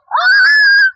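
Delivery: a sudden high scream; startled scream. Real, not acted. Opening the box, a startled high scream. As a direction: shriek out in shock.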